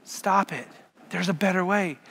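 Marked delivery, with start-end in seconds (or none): none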